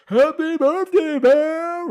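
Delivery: high, loud, drawn-out birthday greeting; dad bellowing from the doorway; cheerful shout. Retold or imitated. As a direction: burst in yelling happy birthday. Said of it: "The door flies open and goes".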